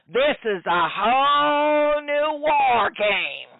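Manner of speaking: mock hillbilly bigot. Mocking a bigot's rant.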